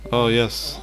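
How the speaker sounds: ordinary talk, nothing marked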